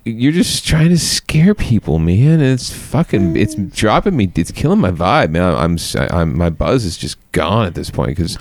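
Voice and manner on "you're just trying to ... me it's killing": exasperated. Real, not acted. Host exasperated at clip.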